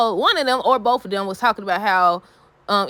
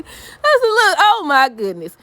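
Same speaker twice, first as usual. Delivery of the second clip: shocked exclamation; 7 semitones higher, 5 dB louder, much slower than usual. Real, not acted. Shocked reaction to the insult.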